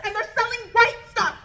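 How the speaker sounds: high, rushed, panicky rant; upset panic. Retold or imitated. Telling friends about the drugs she just saw, high panicked rant. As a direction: freak out to your friends in a high voice. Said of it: "I'm all upset".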